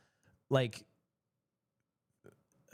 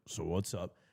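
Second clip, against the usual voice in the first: deep casual manager; 10 semitones lower, 6 dB quieter than usual. Retold or imitated.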